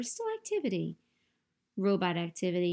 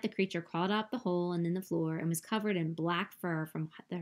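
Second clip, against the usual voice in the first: plain reading voice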